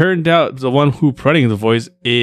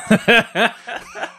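high laugh; 12 semitones higher than usual. Real, not acted. Laughing at own impression.

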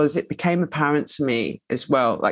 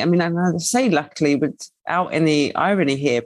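ordinary delivery

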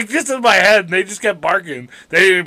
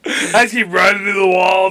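ordinary delivery

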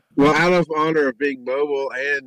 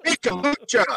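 wrestler entrance call; 11 semitones higher than usual. Pretend.

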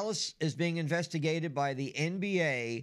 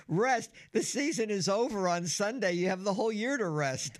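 exasperated rant; 7 semitones higher than usual. Real, not acted. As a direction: vent the frustration, pitched up. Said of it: "that one just drove me nuts".

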